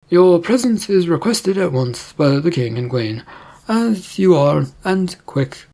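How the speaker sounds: odd, weird-sounding kingly voice; King Horse. Acted. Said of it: "read that in King Horse's voice".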